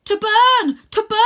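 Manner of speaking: very high, loud urgent yell; boy yelling to Vlad to turn. Acted. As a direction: yell the command to the dragon. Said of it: "I yell".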